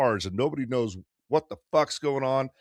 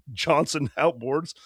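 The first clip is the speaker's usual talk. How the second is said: ordinary talk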